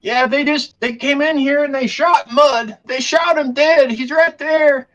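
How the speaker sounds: very high, agitated account; frightened merchant blurting it out in falsetto. Acted. Merchant tells the players about the shooting, panicked. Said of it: "Hicks calls out to you".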